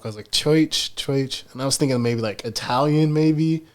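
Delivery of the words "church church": "church" said as "choich", repeated; "church" as "choich", testing the sound; oi vowel. Shown as a demonstration. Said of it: "What accent does that come from?".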